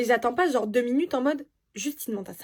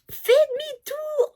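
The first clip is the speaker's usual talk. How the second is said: much higher, slow, drawn-out shout; retold furious yell; 4 dB louder, much slower than usual. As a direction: bark the order like an angry boyfriend yelling in the car. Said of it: "En me criant dessus".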